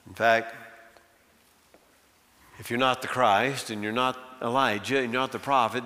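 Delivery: normal talking voice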